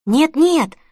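high, loud protest. A narrator's reading. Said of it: "запротестовала она".

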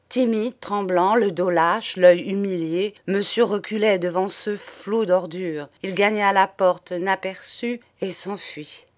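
usual speaking voice

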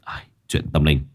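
low, soft, weary gravity; Lão Năm, solemn. Acted. Lão Năm telling Sáu it is no joke, solemn low voice. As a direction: warn in a deep, sighing voice.

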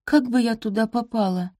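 high-pitched, timid denial; faltering. A narrator's reading. Maid pretends she could not have attended, timid. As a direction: ask it weakly, on the defensive. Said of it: "пролепетала она".

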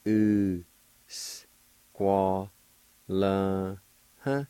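"U, s, qua, la, huh" syllable by syllable, with a breathy "huh" at the end; Cherokee syllables. Shown as a demonstration. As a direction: break "U, s, qua, la, huh" into beats. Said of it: "is pronounced like this".

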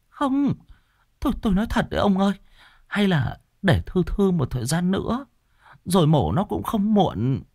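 high, anxious, stammering plea; frightened wife, pitch way up; pleading wife. Acted. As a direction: beg nervously in a high-pitched voice.

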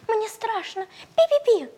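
very high, squeaky and scared; frightened mouse squeak. Acted. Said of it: "заволновался мышонок".

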